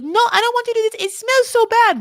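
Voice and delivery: high, loud whiny French accent impression; complaining wife mocked in a French accent. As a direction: imitate Manon whining in a French accent. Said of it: "My French accent is".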